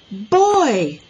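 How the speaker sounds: loud, drawn-out call for attention. A narrator's reading. Teacher hails a boy across the street, calling out. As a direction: hail him from across the street. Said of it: "Miss Binny called out".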